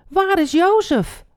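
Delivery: high, loud alarmed question; panicked cry. A narrator's reading. Ruben runs to his brothers, crying out in panic. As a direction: call out in acted panic. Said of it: "riep hij in paniek".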